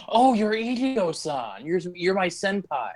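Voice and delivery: high-pitched gushing junior; mock fanboy admiration. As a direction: gush up high to your senpai. Acts out admiring junior meeting senpai.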